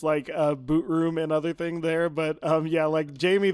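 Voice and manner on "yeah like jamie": ordinary delivery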